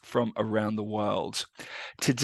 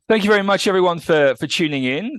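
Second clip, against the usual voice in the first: podcast intro; 8 semitones higher, 9 dB louder, faster than usual. Real, not acted. Podcast host opening the show.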